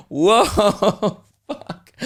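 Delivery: high, loud whoa dropping to a hushed curse; stunned crowd reaction. Acted. Friends all reacting to a savage burn, stunned. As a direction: imitate friends reeling, laughing through it. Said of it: "all the dudes lost their shit".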